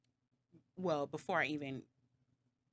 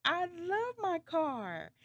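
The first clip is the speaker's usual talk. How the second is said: high, drawn-out mock wail; mock sad; 13 semitones higher, 4 dB louder, much slower than usual. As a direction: whine it slowly, loving and upset. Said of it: "I was sad".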